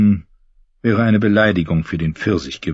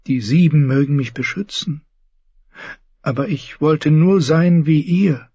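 put-on higher, devout young knight; Lancel; 9 semitones higher than usual. Lancel confessing his new faith to James, earnest. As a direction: speak like a pious, fervent cousin.

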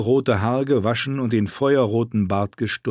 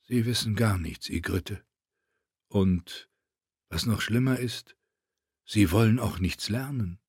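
soft, hushed voice; quietly, as if remembered; 6 dB quieter than usual. Acted.

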